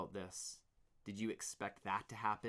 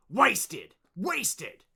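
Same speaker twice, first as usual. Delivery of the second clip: furious shout, much louder; 9 semitones higher, 15 dB louder than usual. Acted. Team leader shouting at the workers, furious. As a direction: bellow it in outrage. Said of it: "Chugger was shouting".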